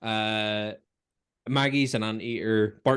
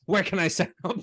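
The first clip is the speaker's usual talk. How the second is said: higher, eager question; quoting Homer wanting that life; mock eager Homer; 7 semitones higher than usual. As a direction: ask it keen to join.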